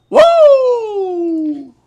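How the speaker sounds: very high joyful woo; whoop. Real, not acted.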